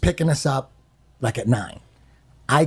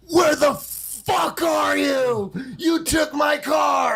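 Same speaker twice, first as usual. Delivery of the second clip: high, slow, shouted rage at a friend; furious yell; 11 semitones higher, much slower than usual. Retold or imitated. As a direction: bellow it like a furious friend on the phone. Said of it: "And he said".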